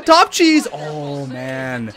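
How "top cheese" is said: high, loud goal call; goal cheer. Real, not acted. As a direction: shout it out as the puck hits top shelf. Celebrating a goal.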